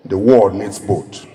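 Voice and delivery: ordinary talk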